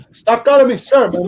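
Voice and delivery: put-on loud Cuban-accented snap; Tony Montana scolds Gump for saying sir. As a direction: bark like Scarface. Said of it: "Tony Montana meets Forrest Gump".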